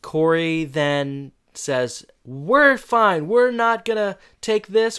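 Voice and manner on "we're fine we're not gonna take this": high, loud mock rallying cry; fired-up strike leader. Student rallying classmates to strike, fired-up mock defiance. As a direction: imitate a defiant kid rousing the troops.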